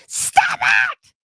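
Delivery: put-on a sudden high shriek at the kids; mock mom yelling "Stop it!" at her kids; yelly mom shout. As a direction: snap like a fed-up mom. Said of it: "Let's say you're a yelly mom".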